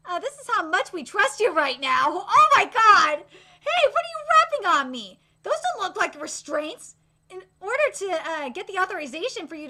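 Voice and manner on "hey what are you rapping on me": usual speaking voice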